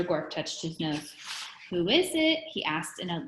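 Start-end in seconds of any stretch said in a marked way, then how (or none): 1.7-2.4 high, lilting Scottish call; pleasant Scottish gentleman. A narrator's reading. Teacher answers a knock at the door, high Scottish lilt. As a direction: call out sweetly as a Scottish gent. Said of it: "the pleasant voice he stole from the Scottish gentleman".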